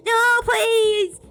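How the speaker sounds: high, loud pleading cry; terrified plea. Acted. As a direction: beg in panic. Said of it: "cried, his expression terrified".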